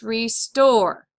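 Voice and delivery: "restore" with the weight on STORE; "restore", second syllable stressed and falling. Shown as a demonstration. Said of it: "so we're gonna accent".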